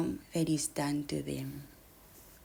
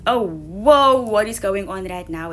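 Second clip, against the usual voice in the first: startled self-check; 9 dB louder than usual. Acted. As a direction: act out catching yourself in alarm. Acts out noticing one's overwhelm.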